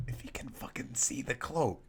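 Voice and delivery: hushed whisper from hiding; hiding under the cloak, whispered. Acted. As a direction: whisper so the janitor can't hear.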